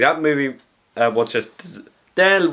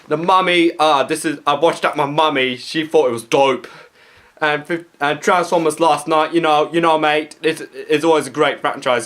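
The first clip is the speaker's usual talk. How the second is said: chav impression; 3 semitones higher than usual. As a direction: play a mouthy British chav host. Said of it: "that was my impersonation of Chav".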